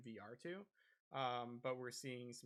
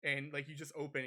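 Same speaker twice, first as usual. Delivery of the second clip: normal talking voice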